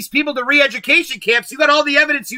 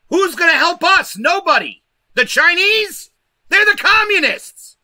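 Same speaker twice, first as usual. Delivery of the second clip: heated rising; 8 semitones higher than usual. Real, not acted. Vlogger asking viewers who will help, heated and high. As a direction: work up to a heated peak.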